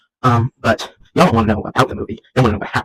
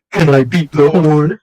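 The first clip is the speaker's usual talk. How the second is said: slow, higher put-on voice; movie character voice; 4 semitones higher, 3 dB louder, slower than usual. Clown asking a biker about his horn, slow character voice. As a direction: draw it out as a character's request. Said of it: "And then he goes".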